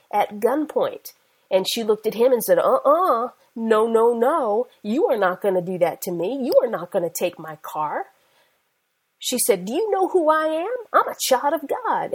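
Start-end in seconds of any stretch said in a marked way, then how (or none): 2.6-8.1 loud, scolding retold refusal, settling into firmness; defiant refusal. Woman refusing a carjacker, acted. 9.7-12.1 higher-pitched, emphatic challenge; bold retold declaration. Woman confronting carjacker, acted.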